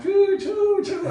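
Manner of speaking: high piercing bird chirps; loud bird chirping. Retold or imitated. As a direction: imitate a noisy bird going off. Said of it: "the bird starts chirping really loud".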